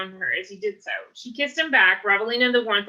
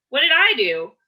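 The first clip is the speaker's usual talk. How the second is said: earnest surprise; 5 semitones higher, 5 dB louder than usual. Acted. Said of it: "Jesse asked in earnest".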